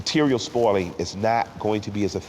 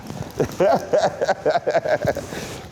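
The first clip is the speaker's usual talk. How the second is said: long breathy laugh, mostly voiceless; 7 semitones higher than usual. Real, not acted. Man laughs after admitting therapy and crying, genuine laugh. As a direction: let out a real, rolling laugh.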